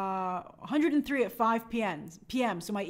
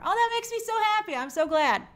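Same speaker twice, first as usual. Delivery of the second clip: excited delight; 9 semitones higher than usual. Real, not acted. Delighted by a viewer's comment.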